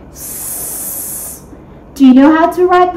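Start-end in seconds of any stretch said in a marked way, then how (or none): 0.1-1.4 S as a long voiceless hiss, sss; letter sound S. Shown as a demonstration. Teacher giving kids the first sound of small, a voiceless sss. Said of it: "That's right".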